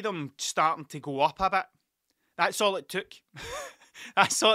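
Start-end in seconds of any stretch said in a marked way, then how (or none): 3.3-4.5 high laughing burst. Real, not acted. Laughing at the kids' quick reaction, high and amused.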